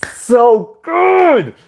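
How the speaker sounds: excited shout. Real, not acted. Reviewer gushing over the emotional rescue, excited shout. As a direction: belt it out in delight. Fan reacting to tearful scene.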